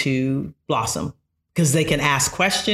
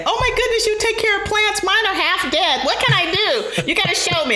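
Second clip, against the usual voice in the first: high, bright, excited gush over a student's video; laughing, thrilled teacher reacting to a student video; gushing teacher reaction. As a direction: squeal with delight like a fan. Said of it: "I'll pause the video and say".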